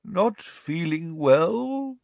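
high, very slow, drawn-out query; hazy. A narrator's reading. Amazed professor repeating pupil's words, hazily. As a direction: repeat it hazily, stretching each word. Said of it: "he repeated hazily".

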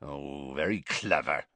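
low, quiet growl; soft, low grumbling growl. A narrator's reading. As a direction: growl it sarcastically. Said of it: "growled Hagrid".

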